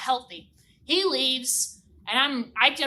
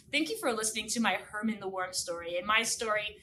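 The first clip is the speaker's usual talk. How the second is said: ordinary delivery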